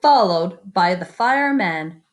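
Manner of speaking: lively picture-book reading; story narration. A narrator's reading. Teacher reading a picture book to the class, lively storytelling. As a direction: read it out to the kids with wonder.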